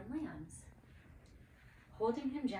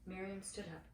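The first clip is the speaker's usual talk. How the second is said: ordinary delivery, nothing marked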